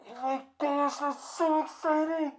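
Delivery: high, loud, thrilled squeal; overjoyed, almost squealing it; gushing excitement. Pretend. Rehearsing a big congratulation.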